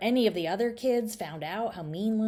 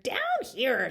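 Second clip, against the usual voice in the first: grubby groggy voice; 8 semitones higher, 4 dB louder than usual. A narrator's reading. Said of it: "said the grubby, groggy voice".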